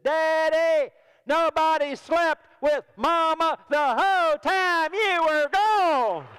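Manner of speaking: put-on high, loud child's shout, slowing at the end; excited boy's yell dragged out; kid shouting. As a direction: blurt it out for everyone to hear. Son blurting out in public.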